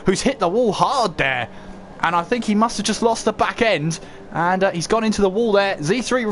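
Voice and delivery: ordinary talk, nothing marked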